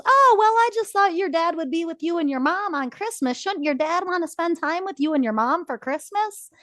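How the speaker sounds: high, loud, faux-innocent mom; imitating mom. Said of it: "And my mom goes".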